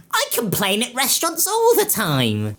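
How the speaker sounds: high, put-on whiny voice; mock commenter. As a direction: mimic a smug complainer. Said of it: "people in the comments are gonna go".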